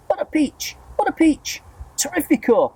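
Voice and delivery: very high giddy cries; excited cry. A narrator's reading. Aunt cries out in delight at the peach. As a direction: squeal with delight. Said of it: "Aunt Sponge cried out".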